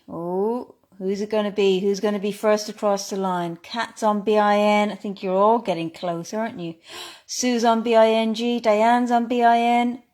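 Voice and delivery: normal talking voice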